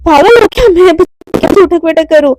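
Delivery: indignant loud refusal; outraged protest. Acted. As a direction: scoff loudly at the order. Mishka outraged at exercise.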